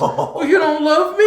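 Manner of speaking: high, loud, drawn-out mock wail; mock hurt wife. Pregnant wife accuses husband over cheese sticks, mock hurt. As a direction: imitate a wounded wife, high and slow.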